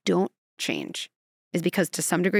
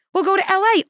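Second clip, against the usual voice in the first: high, loud mock surrender; caving pilot; 11 semitones higher, 7 dB louder than usual. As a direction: cave in loudly to the passengers. Pilot gives in to passengers.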